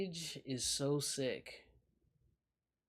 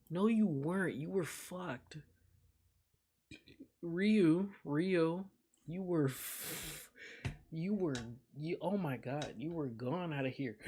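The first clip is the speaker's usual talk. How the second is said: teasing laugh; 3 semitones higher than usual. Real, not acted. Streamer teasing Ryu for being wasted, laughing. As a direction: call him out between laughs.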